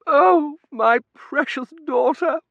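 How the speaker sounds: high, slow wailing lament; hammed-up sob. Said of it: "she sobbed".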